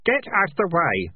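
put-on boy ordering others aside to move the dresser; shouted. A narrator's reading. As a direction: bark it out slow and firm. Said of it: "shouted Keith".

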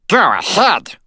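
high, loud helium-like fairy voice; shrill tiny voice. Acted. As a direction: pipe it like a Shakespearean actor on helium. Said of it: "piped a shrill voice".

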